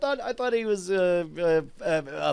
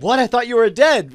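mock surprise; 7 semitones higher, 6 dB louder than usual. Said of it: "We're like".

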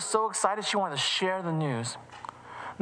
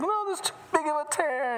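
put-on high little-girl voice, refusing; sulky girl rejecting the fix; upset child; 10 semitones higher than usual. Emily tells Mom it can't be fixed, high and sulky.

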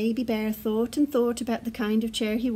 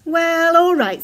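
big, loud dad bear giving in; higher and louder, conceding to the child; Father Bear; 5 semitones higher, 10 dB louder than usual. A narrator's reading. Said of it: "said Father Bear".

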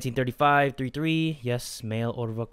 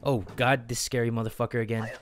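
ordinary talk

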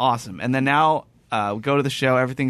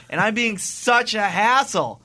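high, drawn-out "hassle", said HASS-ul; exasperated; 8 semitones higher, 3 dB louder, slower than usual. Retold or imitated. Guest mortified at bothering the clerk, exasperated "hassle". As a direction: wail "hassle" slowly with the normal unstressed ending. Rant peaks, then pun follows.

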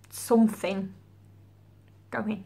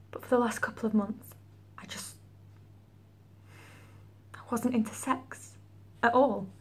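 tragic heroine sorrow. Acted. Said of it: "That's a feeling".

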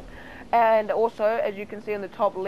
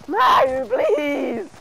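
high, loud pleading cry; desperate plea; 14 semitones higher, 7 dB louder than usual. Real, not acted. Gamer about to be eliminated, high desperate plea. Begging as he dies.

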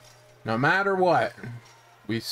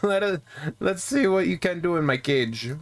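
ogre impression, pitched up; Shrek; 5 semitones higher than usual. Gamer as gang leader inviting a fight in the cage, Shrek voice. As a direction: challenge them as a cartoon ogre. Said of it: "I'm not trying to do a Shrek voice".

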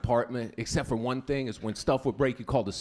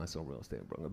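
much lower and softer, muttered; low mumble; 12 semitones lower, 14 dB quieter, faster than usual. Acted. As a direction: drop it low and quiet. Repeats line much lower, quieter.

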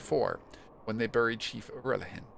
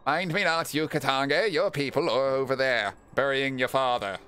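raised, declaimed character voice; solemn watcher character; 3 semitones higher, 6 dB louder than usual. Acted. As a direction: proclaim it like a mysterious watcher.

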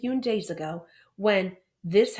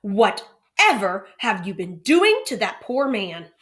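loud and slow exclamation; exclaiming; 8 dB louder, slower than usual. A narrator's reading. Teacher confronting the two boys, loud slow exclamation. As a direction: draw out the outrage. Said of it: "exclaimed Missus Hunt".

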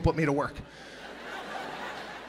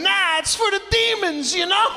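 high, slow mock-ominous voice; high hammed-up spooky warning; spooky explanation; 11 semitones higher than usual. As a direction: warn of demons in an eerie hush. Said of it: "I'd have to explain".

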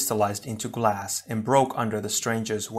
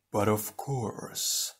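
slow, soft, otherworldly villain voice; a hidden evil being, quietly pleased; delighted hiss; 6 dB quieter, much slower than usual. Acted. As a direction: savour the words like a gloating shadow. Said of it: "voice hissed with delight".

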